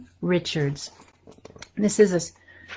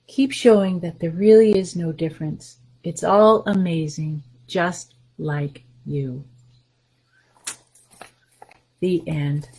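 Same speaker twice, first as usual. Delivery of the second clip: put-on reading to children. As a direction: read it warmly to little listeners, letting each line land. Reads picture book to children.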